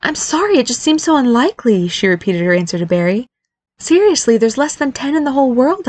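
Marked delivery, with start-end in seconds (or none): 0.0-1.9 high-pitched, apologetic woman's voice; pitched-up, earnest apology; apologetic wife. A narrator's reading. Wife apologizing to upset husband. 3.8-5.9 high-pitched, emphatic woman's voice; pitched up, settling as it goes; insistent wife. A narrator's reading. Wife insisting on her excuse.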